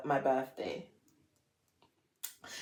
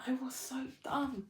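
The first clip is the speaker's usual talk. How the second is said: breathy whispered self-reproach; 4 semitones higher, 13 dB quieter than usual. Real, not acted. Scolding her naive younger self, in a whisper. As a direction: whisper it to yourself, deflated.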